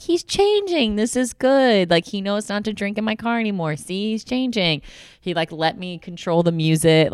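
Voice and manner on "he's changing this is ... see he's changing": much higher, hopeful self-reassurance; mock hopeful self. She reassures herself about her boyfriend, in a high hopeful voice. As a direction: talk yourself into hope, sweetly naive. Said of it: "I was like".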